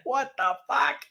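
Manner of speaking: high, drawn-out rising question; stunned disbelief. Retold or imitated. Man charged over an iPad, stunned disbelief. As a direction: stretch it out slowly, pitch climbing in disbelief.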